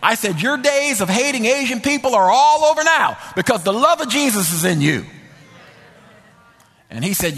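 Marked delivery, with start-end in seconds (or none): 0.4-5.1 fervent. Retold or imitated. Preacher's fervent insistence.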